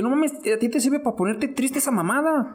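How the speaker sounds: incredulous. Retold or imitated. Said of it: "Que dije".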